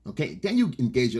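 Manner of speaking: usual speaking voice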